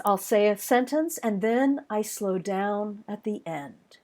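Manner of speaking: "sentence" at normal pace, then drag "slow down at the end"; predictable slowing rhythm. Shown as a demonstration. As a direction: let "at the end" sink slowly into a falling close. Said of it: "a predictable rhythm".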